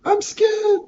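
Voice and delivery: very high squeaky frightened voice; scared character. Acted. Florian tells the party, in a high scared squeak.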